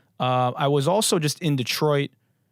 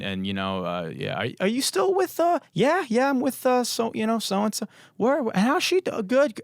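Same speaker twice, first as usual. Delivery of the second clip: higher, hammed-up chit-chat; mock small talk; 3 semitones higher than usual. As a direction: mimic empty wedding pleasantries. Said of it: "every wedding conversation ever".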